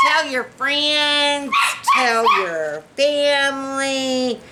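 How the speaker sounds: slow, high, stretched words; drawn-out appeal. Real, not acted. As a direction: stretch out each plea to the viewers.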